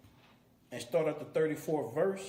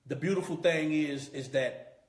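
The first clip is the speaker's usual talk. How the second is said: normal talking voice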